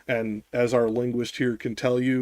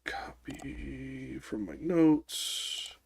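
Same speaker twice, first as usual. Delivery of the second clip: very quiet, drawn-out muttering; absent-minded, hushed self-talk; talking to oneself; 10 dB quieter, much slower than usual. Real, not acted. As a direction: slowly murmur it under your breath while busy. Talking to self while finding notes.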